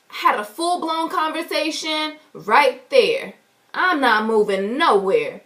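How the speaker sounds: usual speaking voice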